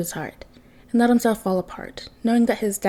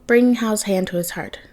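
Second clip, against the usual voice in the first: usual speaking voice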